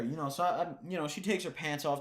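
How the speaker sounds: normal talking voice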